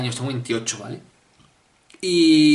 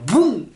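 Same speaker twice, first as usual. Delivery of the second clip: imitated bang; 10 semitones higher, 6 dB louder than usual. Dog jumps out suddenly.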